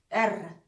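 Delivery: एर with a throaty gurgled r; growled German R. Shown as a demonstration. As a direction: roll एर in the throat. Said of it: "आर को थोड़ा सा घुर्रा के बोलते हैं". (घुर्रा के एर)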